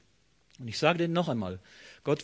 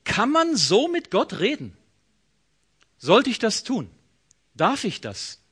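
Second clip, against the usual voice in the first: doubtful questioning; 6 semitones higher, 5 dB louder than usual. Acted. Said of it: "du fragst dich vielleicht".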